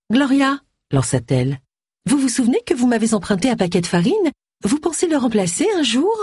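high-pitched, insistent little widow; Jenny, pointed. Acted. Widow asking a borrower to repay her flour, high and pointed. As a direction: lift the voice and press for what is owed. Voices Jenny accosting Gloria.

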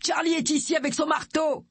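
high-pitched shouted announcement; calling out. A narrator's reading. Man shouting through the door.

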